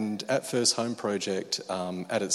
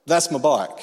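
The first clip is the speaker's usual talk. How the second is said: firm claim; 6 semitones higher, 10 dB louder than usual. Retold or imitated. Confronting thief over his bike.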